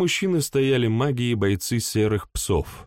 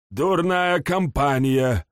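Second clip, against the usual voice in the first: put-on displeased old man; 6 semitones higher, slower than usual. A narrator's reading. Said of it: "недовольно повёл плечом бог".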